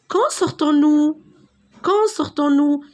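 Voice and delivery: high, loud "Quand sortons-nous?" with a rising voice. Shown as a demonstration. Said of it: "vous devez toujours élever un peu la voix".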